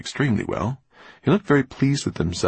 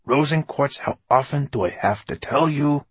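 higher-pitched exasperated scolding; snapping; 8 semitones higher than usual. A narrator's reading. Writer scolds his glass man, snapping. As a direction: snap at a careless helper. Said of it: "snapped Fenoglio".

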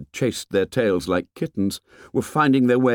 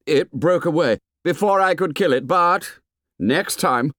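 higher, lightly voiced defiant boy; a boast pitched up, defiant; challenging; 9 semitones higher, 3 dB louder than usual. A narrator's reading. Jack vows to kill the pig next time, challenging.

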